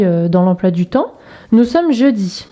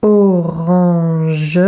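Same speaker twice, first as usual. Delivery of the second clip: drawn-out, lower "Orange"; slow dictation for copying; syllable by syllable; 4 semitones lower than usual. Shown as a demonstration. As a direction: stretch "Orange" out with the final "ge" sounded. Slow dictation of word to copy.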